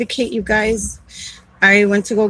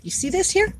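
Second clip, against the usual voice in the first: high pointed question; 10 semitones higher, 6 dB quieter than usual. Retold or imitated. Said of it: "And I said".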